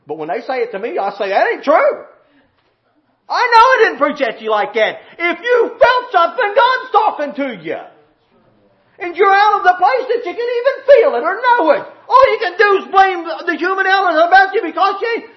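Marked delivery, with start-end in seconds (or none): 1.3-2.0 loud, higher-pitched retort; indignant denial. Retold or imitated. Preacher retorting when they say it to him, indignant. 3.3-15.3 loud, high preacher's cry; full-force preaching. Real, not acted. Preacher confronting the congregation about feeling God, full-force preaching.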